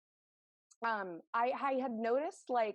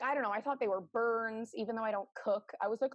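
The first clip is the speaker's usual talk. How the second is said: ordinary delivery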